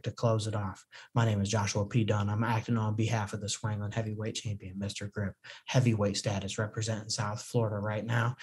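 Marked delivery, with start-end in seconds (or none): none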